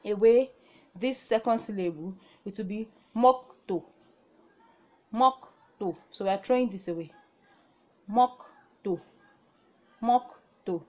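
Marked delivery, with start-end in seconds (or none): 2.5-10.8 mokto said clearly with a falling tune; Korean reading. Shown as a demonstration. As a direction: model mokto for the class. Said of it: "if I want to pronounce this".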